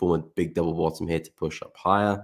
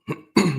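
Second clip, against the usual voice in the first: sharp throat clear against a croaky voice; 11 semitones higher than usual. Real, not acted. Streamer fighting a croaky voice, clears throat. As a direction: clear the throat hard to get the voice back. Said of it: "My voice just doesn't wanna work today".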